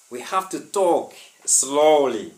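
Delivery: slowed-down, deliberate teacher delivery; talking slowly. Shown as a demonstration. Teacher to online students promising to slow down, slow and deliberate. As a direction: spell it out slowly for the class. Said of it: "I have decided to speak slowly".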